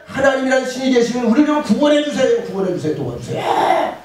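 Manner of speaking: high, loud begging that fades to a breathy plea; pleading cry. Acted. 구원을 바라며 하나님께 매달리는 사람들, 높고 크게 애원하다가 숨죽여 잦아드는 목소리. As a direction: beg God to save you, then fade out. People begging God for rescue.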